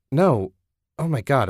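loud, high horrified refusal; shocked. Acted. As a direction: recoil in shock. Ted horrified at her offer.

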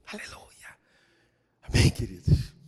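whispered Aleluia and Amém; whispered praise. Real, not acted. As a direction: breathe it to the congregation.

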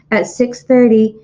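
ordinary delivery

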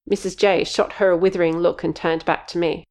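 usual speaking voice

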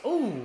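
loud, high "ooh" at a hit; sudden gasp-like fan reaction; impressed ooh. Real, not acted.

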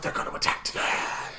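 whispered secret; whispering the spy's real plan. Retold or imitated. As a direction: breathe it like a confided truth.

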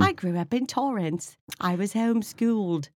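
a lighter mock British accent. Still mocking the actor in a hammed-up British accent. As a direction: keep the jokey British accent going. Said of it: "Talking like this".

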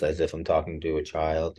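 ordinary talk

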